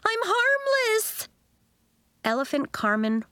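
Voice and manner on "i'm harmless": much higher, slow cry; pleading yell. A narrator's reading. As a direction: stretch it out to calm everyone. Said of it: "she yelled at the chaos".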